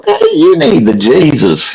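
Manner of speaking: high-pitched mock Southern preacher call; fake Southern accent. Caller getting on the host's case, fake Southern accent. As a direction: sing out the words in a put-on Southern accent. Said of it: "I had my fake Southern accent".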